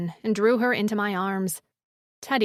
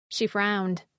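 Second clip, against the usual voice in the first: plain reading voice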